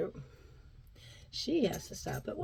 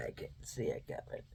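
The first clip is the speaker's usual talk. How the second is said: low, hushed whisper; quiet ominous whisper; 14 dB quieter, faster than usual. Real, not acted. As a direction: breathe it under your voice. Whispered aside.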